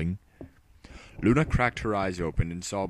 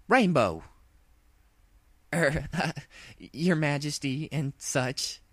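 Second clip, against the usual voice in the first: scolding then flustered; 8 semitones higher, slower than usual. Acted.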